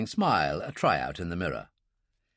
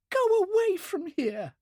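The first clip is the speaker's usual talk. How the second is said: desperate. A narrator's reading. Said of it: "Her voice was low and desperate".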